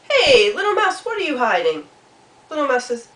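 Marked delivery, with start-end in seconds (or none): 0.1-1.9 loud swooping hail; boisterous Frog. Acted. Frog asks Mouse what is hidden, loud and curious. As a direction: call out boldly, sliding down through the question.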